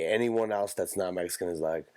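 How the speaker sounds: usual speaking voice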